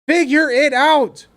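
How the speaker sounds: "Figure" slowed and stressed; slow emphatic. Shown as a demonstration. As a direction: spell it out slowly, word by word. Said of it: "let's figure it out".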